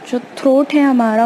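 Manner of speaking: normal talking voice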